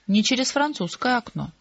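ordinary delivery

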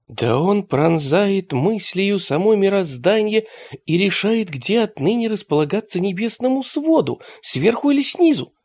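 a hint of lofty speculation; lightly voiced musing. A narrator's reading. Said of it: "догадался Теретомба".

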